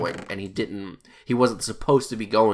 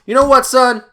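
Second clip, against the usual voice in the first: much higher and louder, a dramatic turn; sudden odd voice; 13 semitones higher, 12 dB louder than usual. Retold or imitated. Driver suddenly addressing the new guy, high and loud. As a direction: swing into a big preachy tone. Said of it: "why his voice went into that kinda".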